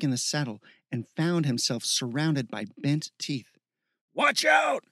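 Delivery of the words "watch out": high, urgent shout; warning shout. A narrator's reading. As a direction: yell a sudden battlefield warning. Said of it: "a voice shouted from nearby".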